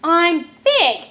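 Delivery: put-on high, loud little girl protest; insistent little sister. Anna insisting to Felix she is big, high and loud. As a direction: stamp it out like a small kid.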